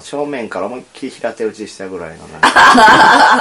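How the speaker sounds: laughing through the words; words breaking into a loud laugh. Real, not acted. Teasing a friend's sleeping pose.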